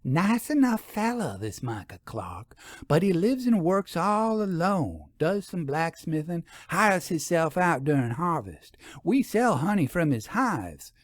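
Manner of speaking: lightly voiced storekeeper, slowing on the name Micah Clark; a hint of a small-town gossip telling a secret; gossiping townswoman. A narrator's reading. Narrator holds Hooper's voice.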